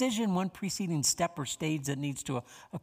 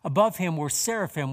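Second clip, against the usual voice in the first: scripture reading; 4 dB louder than usual. Acted. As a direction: let each phrase of scripture land. Reads Isaiah six aloud.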